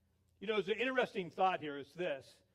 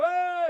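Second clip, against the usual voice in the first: high, loud whoop; imitated yelling; 11 semitones higher, 11 dB louder than usual. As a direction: mimic an excited boatload's holler. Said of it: "they're all yelling".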